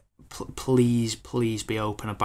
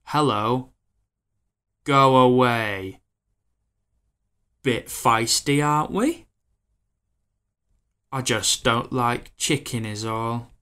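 put-on slow, loud, stagey old-film delivery; mock stiff sixties movie line; stilted sixties film dialogue; 6 dB louder, slower than usual. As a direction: ham it up like a sixties film actor. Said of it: "it's like".

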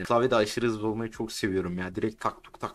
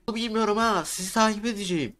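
much higher, bright sing-song "Yolu bilmiyorum ha"; high playful call; 11 semitones higher, 4 dB louder, faster than usual. Shown as a demonstration. Shows the crew how he meant his shout, high and playful. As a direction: toss it up high and light, not a yell. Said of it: "Ben şey gibi düşünüyorum bağırıp".

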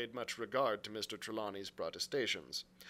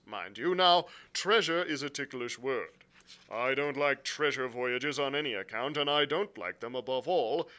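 louder, firm captain's voice; blunt sea captain; 6 semitones higher, 9 dB louder than usual. Acted. Captain explaining his orders to the gentlemen, bluntly. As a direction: state the grievance firmly and formally. Captain's dialogue beside narration.

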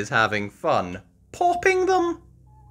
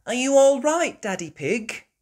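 high, louder concerned voice; Mummy Pig concerned; 12 semitones higher, 6 dB louder than usual. Acted. Mummy Pig asking after Daddy, high concern. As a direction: check on him anxiously. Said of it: "says Mummy Pig".

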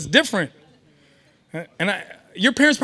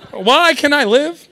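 ordinary talk, nothing marked

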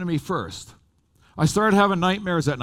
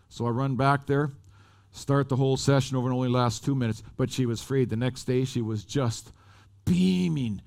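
ordinary talk, nothing marked

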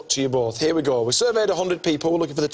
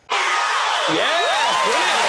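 excited cheer; 12 semitones higher, much slower than usual. Real, not acted. Host cheers correct answer.

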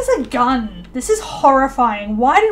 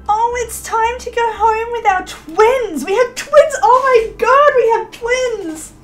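much higher, louder, drawn-out joy; excited squeal; 9 semitones higher, 6 dB louder, slower than usual. Real, not acted. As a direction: gush it out in delight. Going home with twins.

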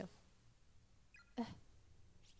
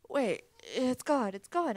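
panicked cry; 4 semitones higher, 10 dB louder, slower than usual. Acted. Mayu finds her charm missing.

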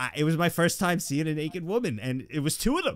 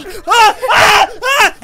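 shrill startled yelps, much higher and louder; panicked yelps; 17 dB louder, much slower than usual. Retold or imitated. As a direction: yelp like a caught kid. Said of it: "And I go".

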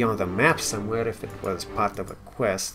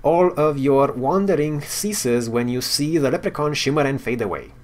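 reading game text aloud like a storyteller; story narration; 3 semitones higher, 5 dB louder than usual. Acted. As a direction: narrate the quest scene with flair.

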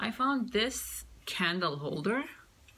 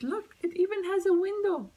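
excited squeal; 11 semitones higher, 5 dB louder, faster than usual. Real, not acted. Gushing over a toy shop.

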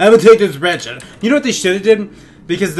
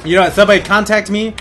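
normal talking voice

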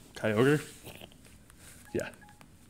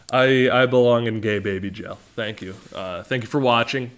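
ordinary talk, nothing marked

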